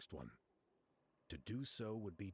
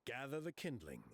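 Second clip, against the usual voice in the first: ordinary talk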